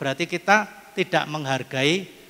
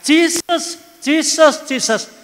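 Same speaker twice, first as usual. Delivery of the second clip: high, louder repeated calls of Jesus; mock panicked cries, much higher; old woman crying out; 10 semitones higher, 4 dB louder, slower than usual. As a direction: imitate a frantic grandma calling on Jesus. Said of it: "seandainya Oma itu tidak teriak-teriak".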